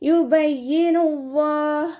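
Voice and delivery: യുബയ്യിനുല്ലാഹ് with doubled യ്യ, slow chant; tajweed recitation. Shown as a demonstration. Teacher models the word for students, slow chant. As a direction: recite യുബയ്യിനുല്ലാഹ് with heavy ല്ലാ. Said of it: "ഇയാൾക്ക് ശ്രദ്ധ വന്നിട്ടുണ്ട്".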